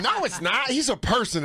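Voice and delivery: put-on high, scornful girl voice; classmate correcting. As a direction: correct him like a smug classmate. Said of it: "She went".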